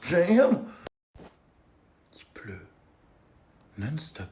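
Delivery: whispering. Real, not acted. Whispering about the rain.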